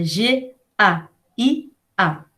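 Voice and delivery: G A I A read slowly letter by letter; teacher dictating the letters G A I A; spelling out letters. Shown as a demonstration. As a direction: spell each letter G, A, I, A apart. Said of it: "Não é Gaia".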